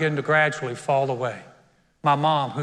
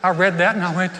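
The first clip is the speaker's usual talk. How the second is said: normal talking voice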